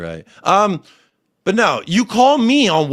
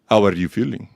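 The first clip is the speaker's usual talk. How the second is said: much lower, put-on mocking voice; Don Hassam impression; 11 semitones lower than usual. Caller checking on someone who conceded, deep imitation voice. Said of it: "there's Don Hassam".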